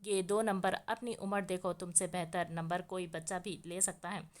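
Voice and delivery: ordinary delivery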